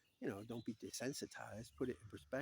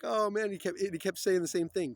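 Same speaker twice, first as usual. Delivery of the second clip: higher, louder mock of a cracked-up friend; amused excitement; 8 semitones higher, 11 dB louder than usual. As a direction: retell it loud and tickled. Retells friend laughing, cracking up.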